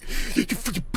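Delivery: sharp frightened gasp; startled gasp. Real, not acted. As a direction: gasp at a sudden jump scare. Startled by jump scare in game.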